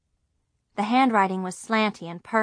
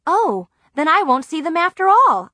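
suddenly relieved and cheerful, higher and louder; lighting up with hope; brightening; 9 semitones higher, 8 dB louder than usual. A narrator's reading. Girl hears the visit clashes with her trip, brightening.

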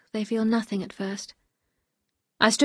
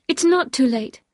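higher, louder plea with NOT stressed; insistent protest; 4 semitones higher, 5 dB louder than usual. Acted. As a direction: protest earnestly, lifting the voice on NOT. Wife protests to husband.

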